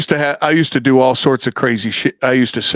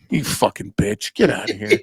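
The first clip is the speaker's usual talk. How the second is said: soft, breathy retold curse; hissed insult under the breath; muttered curse; 6 semitones lower, 6 dB quieter than usual. Woman to bouncer, muttered curse.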